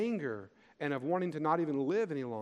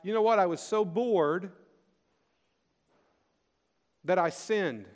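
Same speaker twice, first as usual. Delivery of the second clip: higher, a little louder, slowing on so bored; mock excuse; 3 semitones higher, 4 dB louder than usual. Preacher voicing a bored believer blaming God, mock excuse. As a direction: voice a flippant sinner's excuse. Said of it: "It might sound like".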